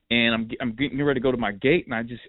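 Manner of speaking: ordinary talk, nothing marked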